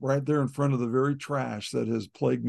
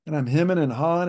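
ordinary delivery

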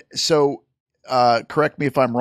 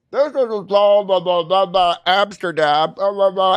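higher, garbled muffled announcer voice; mock an unintelligible live sound system; mumbled PA announcement; 7 semitones higher than usual. Mocking a band's mumbled intro through a PA. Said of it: "it always just sounds like".

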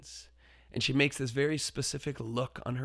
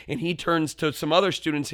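ordinary talk, nothing marked

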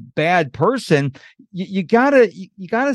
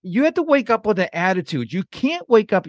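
ordinary talk, nothing marked

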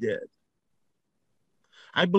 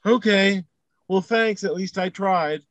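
much higher, feeble, resigned pushover voice; meek giving up; 7 semitones higher than usual. Pretend. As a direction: play the guy who gives up too easily. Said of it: "what would have happened if".

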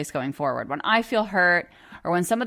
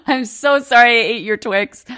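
imitating husband apologizing; 3 semitones higher than usual. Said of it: "he comes into the room and he's like".

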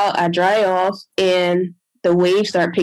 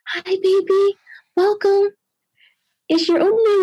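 tender greeting; 12 semitones higher than usual. Retold or imitated. Mother greets her newborn, high tender baby voice. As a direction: coo gently to the newborn.

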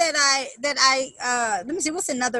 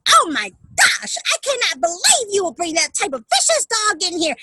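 berating woman; 5 semitones higher, 6 dB louder than usual. Retold or imitated. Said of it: "she was berating my client".

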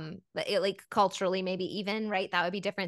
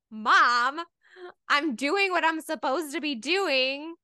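higher, slow mock protest to a parent; put-upon son; 8 semitones higher, 3 dB louder, much slower than usual. Young Jesus justifying himself to his mom, mock protest. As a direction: stretch it out like a kid answering Mom. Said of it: "he's all like".

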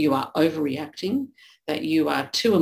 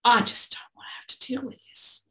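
breathy, higher whispered brush-off; dismissive whisper; 8 semitones higher than usual. Retold or imitated. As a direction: whisper it, wanting out of the conversation. Neutral partner brushing off tears.

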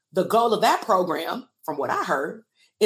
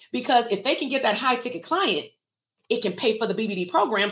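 ordinary delivery

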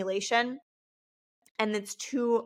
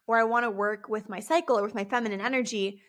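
normal talking voice

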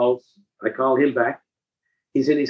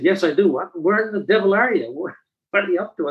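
a touch of mock astonishment, pitched higher; retold amazement; 6 semitones higher than usual. To the caller over the noise, lightly amazed. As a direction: play up your own surprise, half-joking. Retelling his amazed reaction on phone.

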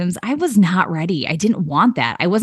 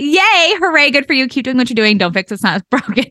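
higher, genuinely excited congratulations; really mean it this time, cheer them on; earnest, higher hooray, no sarcasm; sincere cheer; 6 semitones higher than usual. Real, not acted. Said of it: "I'm not saying that in a sarcastic way".

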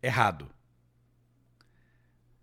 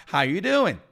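casual greeting; 5 semitones higher, 6 dB louder than usual. Shown as a demonstration. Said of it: "também existe um jeito de falar".

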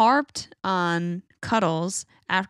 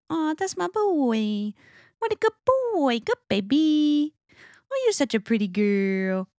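put-on baby talk to a dog; 9 semitones higher than usual. Shown as a demonstration. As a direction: coo it like praising a puppy. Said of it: "That's how I talk when I do baby talk".

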